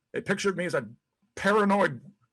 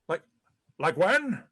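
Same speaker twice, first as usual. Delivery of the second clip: ordinary delivery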